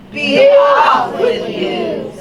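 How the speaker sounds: high, loud, drawn-out shout; troll's shouted dismissal. Acted. Troll lets the second goat go, shouting. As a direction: send them packing at full volume.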